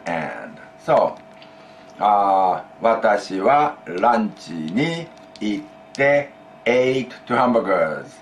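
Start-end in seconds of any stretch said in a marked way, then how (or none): 2.8-8.1 slow, clear Japanese example sentence; slow model sentence. Shown as a demonstration. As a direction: spell it out slowly for learners. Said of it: "Went to lunch and ate two hamburgers".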